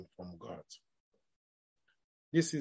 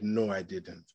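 low, soft confession; calm admission; 9 semitones lower, 9 dB quieter than usual. Retold or imitated. Child owning up to father about Bible reading, calm and subdued. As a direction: drop the voice and admit it quietly. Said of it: "He stayed calm".

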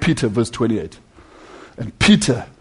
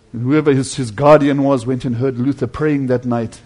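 ordinary talk

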